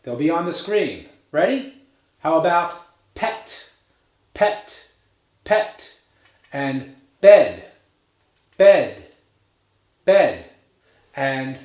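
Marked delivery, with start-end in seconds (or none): 3.1-5.9 "pet" whispered with short lax E; voiceless demo of "pet"; whispered model pet. Shown as a demonstration. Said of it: "a few other E words". 7.2-10.5 "bed" said loud with short lax E; strong clear demo of "bed"; loud model bed. Shown as a demonstration. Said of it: "a few other E words".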